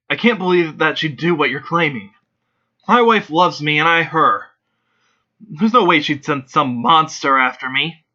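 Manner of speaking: mildly angry, scared father. Acted. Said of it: "He seems angry and scared".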